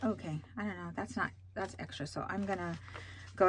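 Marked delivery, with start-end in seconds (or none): none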